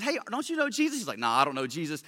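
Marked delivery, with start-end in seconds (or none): 0.0-1.0 higher, softly imitated little girl; a hint of a little girl's voice; lightly voiced little girl. Little girl questioning Peter about Jesus, lightly imitated. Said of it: "a little girl who said". 1.2-2.0 low, cowardly mumbled denial; mock coward. Peter denying Jesus to a little girl, mock cowardly. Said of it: "like, Mister Coward".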